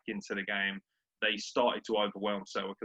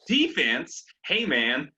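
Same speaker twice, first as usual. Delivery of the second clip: louder, hammed-up American echo; mock American accent; 3 semitones higher, 6 dB louder, much slower than usual. British fan teasing his Yank co-host, mock American accent. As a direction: parrot him in a put-on American accent. Mimicking the American co-host's word.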